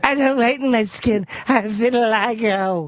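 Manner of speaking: high-pitched mock celebrity voice. Mocking a pop star's denial about his skin, high impression. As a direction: plead it in a high put-on voice. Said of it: "Fucking creep with his skin whitening stuff".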